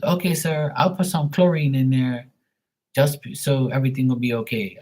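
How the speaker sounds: high-pitched mock Filipino accent. Pool guy offering chlorine instead, mock Filipino accent. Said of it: "making fun of his voice and his accent".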